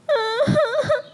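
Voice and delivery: put-on high whining cry of a child; high whimpering cry; child's whiny wail. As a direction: wail like a kid begging. Child cries to go along.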